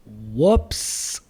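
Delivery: a loud, mocking "Whoops" with a rising end; mocking echo. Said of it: "for him to say".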